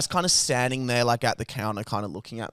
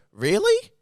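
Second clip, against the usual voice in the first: pitched-up mock disbelief; a scornful little "Really?" at the counter guy; sarcastic really; 6 semitones higher, 3 dB louder than usual. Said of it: "I wanted to just go".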